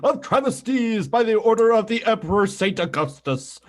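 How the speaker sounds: high, ringing theatrical proclamation; Frollo. Acted. Host decrying a travesty to co-host, imperious villain voice. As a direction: declaim it with booming outrage, falling at the end. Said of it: "Can you say that again in Frollo's voice?".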